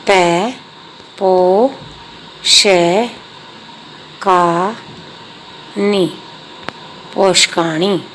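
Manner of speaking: letters said one at a time with pauses, then पोषकाणी whole; spelling out letters. Shown as a demonstration. Teacher joining letters into a word for pupils, slow and separate. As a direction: sound it out letter by letter. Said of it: "अगला है".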